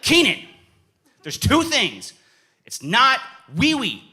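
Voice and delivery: high, loud exasperated prodding. Retold or imitated. Player to his partner as time is ticking, exasperated. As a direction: press your clueless partner, voice jumping up. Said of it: "I'm getting kind of exasperated".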